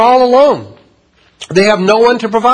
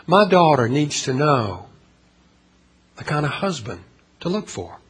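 soft, lowered voice quoting a mother; retold hushed plea; 4 semitones lower, 8 dB quieter than usual. Preacher retelling a mom's concern for her daughter, hushed.

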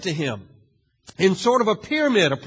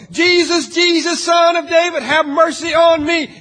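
much higher and louder desperate plea; crying out louder; 10 semitones higher, 8 dB louder than usual. Acted. Blind man crying to Jesus over the crowd, louder and desperate. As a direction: cry out over the crowd, pleading hard. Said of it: "cries out all the more".

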